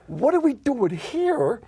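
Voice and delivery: high, loud, slow puzzled question; startled disbelief, drawn out; bewildered question. Retold or imitated. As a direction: ask it like you can't believe where you are. Retold bewildered question at donkey house.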